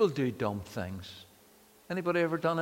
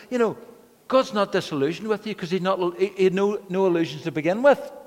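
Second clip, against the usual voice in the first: rising, louder, higher preaching tone; starting to preach; 5 semitones higher, 5 dB louder than usual. Retold or imitated. Pastor to the weeping hairdresser, starting to preach. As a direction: warm up into a sermon at her. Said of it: "I was starting to preach".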